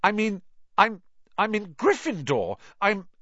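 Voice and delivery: high, rushed and unsure; flustered boy. A narrator's reading. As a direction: stumble over the words. Harry voiced, flustered.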